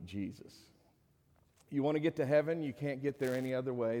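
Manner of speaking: normal talking voice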